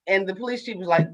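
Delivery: ordinary talk, nothing marked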